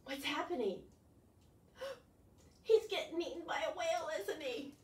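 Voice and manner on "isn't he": ordinary delivery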